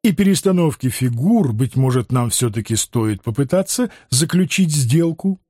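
ordinary delivery

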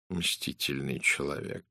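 low, soft, slow murmur; musing aloud, quiet and slow; muttering to oneself. Acted. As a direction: mutter it privately, half under your breath. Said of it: "пробормотал Пуаро про себя".

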